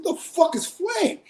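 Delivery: high, baffled outburst; retelling his own shocked question to the dealer; incredulous question. Retold or imitated. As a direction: demand to know what flame is, way up high. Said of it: "I said".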